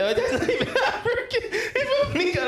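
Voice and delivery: high, fast laughing talk. Real, not acted. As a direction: laugh through the words. Laughing while talking.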